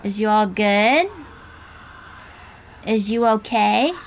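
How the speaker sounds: ordinary delivery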